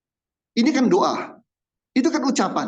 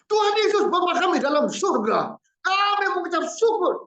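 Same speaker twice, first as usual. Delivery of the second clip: much higher put-on praying voice; high, theatrical mock prayer voice; mock showy prayer; 14 semitones higher, 3 dB louder than usual. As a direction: parody a churchy sing-song prayer. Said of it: "orang doa kayak gitu".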